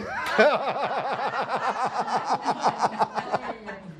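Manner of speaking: real high laugh breaking out; high burst of laughter. Real, not acted. Preacher laughing at his father's reaction. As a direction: laugh hard at the memory.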